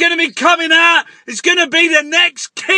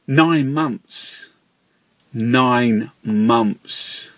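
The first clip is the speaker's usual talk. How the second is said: much lower, slowed, echoing doom voice; ominous doom voice; 5 dB quieter, much slower than usual. Acted. Ranter pronouncing the nine months like a curse, deep doom voice. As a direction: intone it like a prophecy of doom.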